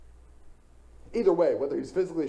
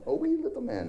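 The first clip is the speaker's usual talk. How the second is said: very high, jokey Irish leprechaun lilt; a cartoon Irish leprechaun, hammed up for a laugh; Irish leprechaun accent; 14 semitones higher than usual. Preacher mocks the church song in an Irish leprechaun accent. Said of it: "That's terrible. Probably racist".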